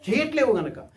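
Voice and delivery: ordinary delivery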